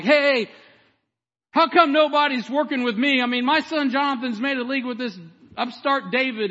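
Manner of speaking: high, shouted rant of an aggrieved king; Saul, fired up. Acted. Preacher acts out the king berating his men, fired up. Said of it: "Saul's fired up. He's like".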